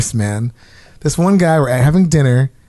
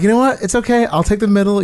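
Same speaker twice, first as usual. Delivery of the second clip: high, overly sweet giving in; mock-cheerful yielding, pitched up; sweet placating tone; 7 semitones higher, faster than usual. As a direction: play nice and give up the seat, voiced high. Retold offer to elderly seatmate.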